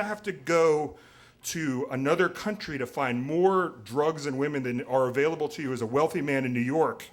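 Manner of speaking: normal talking voice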